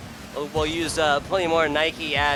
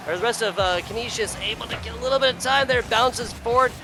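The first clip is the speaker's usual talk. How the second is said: raised-pitch running commentary; live play-by-play; 5 semitones higher than usual. Real, not acted. Caster tracking Stuff's bounce attempts for viewers, live play-by-play. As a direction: follow the ball keenly, touch by touch.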